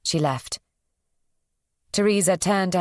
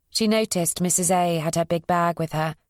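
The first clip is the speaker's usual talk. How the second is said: plain reading voice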